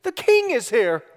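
put-on high little-girl voice, announcing; high toddler squeal of greeting; three-year-old daughter. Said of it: "Maggie looks at me, my three-year-old, and says".

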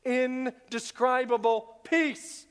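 normal talking voice

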